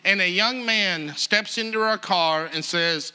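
ordinary delivery

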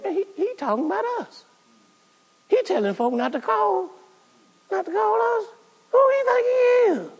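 high mocking falsetto, scoffing and indignant; mock the offended leaders in a squeaky put-on voice; jealous religious leaders. Preacher mimics leaders complaining about Jesus, high mocking falsetto. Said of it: "why the leaders were Jesus' biggest enemies".

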